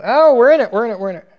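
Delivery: ordinary talk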